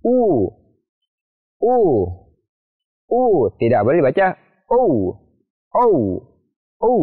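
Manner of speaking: "U" with lips pushed forward and rounded. Shown as a demonstration. Teacher showing students the rounded "U". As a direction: keep the mouth pursed on "U". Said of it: "tetap mulut kita munyung".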